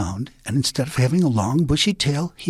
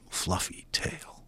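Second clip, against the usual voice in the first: slow hushed whisper; jealous whisper; 6 semitones lower, 13 dB quieter, slower than usual. A narrator's reading. Narrator hushing over Coyote's jealous longing. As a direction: breathe the words out with envy.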